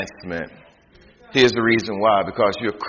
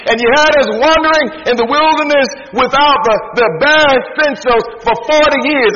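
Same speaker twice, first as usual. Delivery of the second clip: raised, indignant questions to God; voicing Israel's complaint; 10 semitones higher, 6 dB louder than usual. Preacher voicing Israel's complaint to God, indignant. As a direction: preach Israel's grievance with mock outrage.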